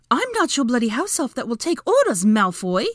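high, loud, indignant outburst; angry interruption. A narrator's reading. Hermione refusing Malfoy's orders, angry interruption. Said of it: "Hermione interrupted angrily".

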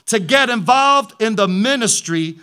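building pulpit climax; preaching build. Acted. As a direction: drive the point home to the congregation.

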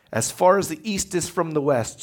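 usual speaking voice

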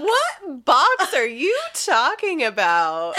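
high, loud, drawn-out mock bafflement; feigned innocence. Co-host as spy denies swallowing any box, feigned innocence. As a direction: stretch it slowly, hamming up the innocence.

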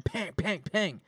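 high-pitched imitation of bangs; imitated banging. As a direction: ring out three bangs. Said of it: "you hear big bangs again".

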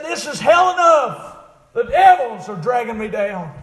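loud, high, drawn-out wail of doom; preached deathbed cry. Retold or imitated. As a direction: cry out as the dying man, in preaching voice. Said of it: "also cried out".

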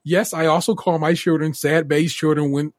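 ordinary talk

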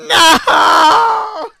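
high, loud shriek of protest; losing it. Real, not acted. Host protesting a friend's joke, shrieking in outrage. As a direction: shriek it, dragging out the words. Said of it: "You're about to lose it".

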